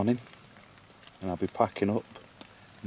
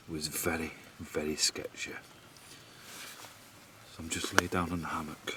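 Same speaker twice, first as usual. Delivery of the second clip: normal talking voice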